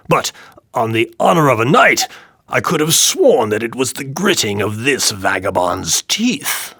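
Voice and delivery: booming, mocking tyrant; drunken king crowing over his joke; loud jeering king. A narrator's reading. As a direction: taunt the dwarf loudly. King voiced in story.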